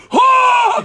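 very high, loud mock shriek; imitating a friend's startled scream; freaked-out scream. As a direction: shriek in sudden shock. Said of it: "He freaks out".

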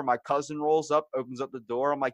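ordinary talk, nothing marked

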